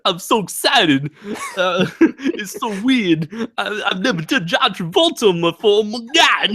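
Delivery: high, laughing Travolta voice; John Travolta impression. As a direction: gush like Travolta, high and giggly. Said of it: "asking for a John Travolta impression".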